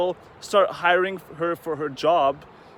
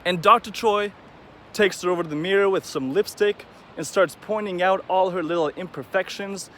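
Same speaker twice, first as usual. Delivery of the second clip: ordinary delivery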